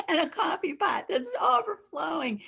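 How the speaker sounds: re-enacted fed-up outburst; retold angry yelling. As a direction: top off the rant with a high-pitched wail. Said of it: "I just started yelling".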